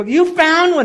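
high, loud cry of amazement; thrilled shouting. Retold or imitated. Wife reacts to husband's find, thrilled and shouting. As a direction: mimic a wife erupting with excitement. Wife's excited reaction acted out.

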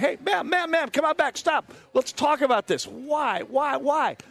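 high, urgent calling out; mock pleading call to come back; calling after her. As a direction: call after a woman who's running off.

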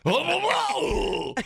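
long, high whoop of a mock drunk; drunk idiot tourists. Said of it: "a group of those drunk idiots".

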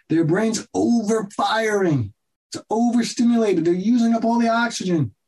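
excited. Real, not acted. Emphatic explanation, voice rising high.

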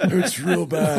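slow, high and pained, a bit hammy; gravely ill Tony Romo. Pretend. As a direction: moan it like you can barely breathe. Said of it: "Your Tony Romo voice".

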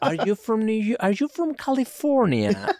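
high, mock-polite asking voice; imitating questioners. Imitating people who politely guess where he's from, high and mocking. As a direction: pitch it up like a too-polite stranger.

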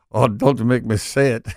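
high-pitched mock French accent; Jose Gutierrez. French chef begged to say it, mock French protest. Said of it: "in a French accent".

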